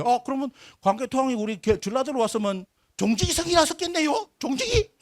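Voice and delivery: rising to a high-pitched mocking question; imitating Jeolla locals. Lecturer retelling what Jeolla people said, imitating them. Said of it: "전라도 가니까 그러더라고요".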